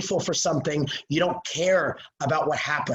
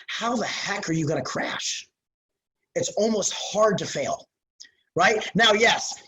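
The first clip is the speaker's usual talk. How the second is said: ordinary delivery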